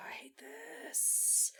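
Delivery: frustrated whisper. Real, not acted. Player frustrated while choosing spell.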